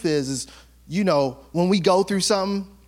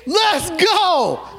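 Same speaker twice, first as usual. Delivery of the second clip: fired-up shout; 8 semitones higher, slower than usual. Real, not acted. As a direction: let the joy burst out. Preacher fired up by the story.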